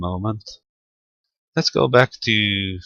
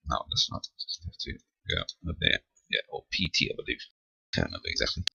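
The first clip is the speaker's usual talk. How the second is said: half-whispered, barely voiced aside; muttering to himself; 7 semitones lower, 13 dB quieter, much faster than usual. Real, not acted. Operator checking his own setup, muttering under his breath. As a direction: mumble it under your breath while searching the screen.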